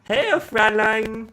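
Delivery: high, slow German villain voice; movie villain german accent. Pretend. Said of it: "German accent".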